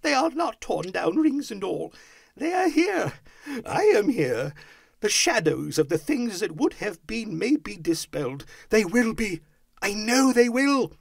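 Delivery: high, rapturous exclaiming; crying out, overjoyed. A narrator's reading. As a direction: cry it out with growing certainty. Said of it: "cried Scrooge".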